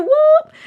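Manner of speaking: very high, loud whoop; cheering whoop. Real, not acted. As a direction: let out a quick excited whoop. Cheering a fellow cat owner.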